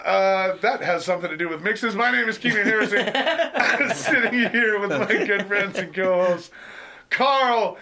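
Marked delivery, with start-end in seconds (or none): 1.9-7.8 podcast host intro. Acted. Host introducing his co-hosts, in a hammed-up announcer voice. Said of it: "Great little intro today".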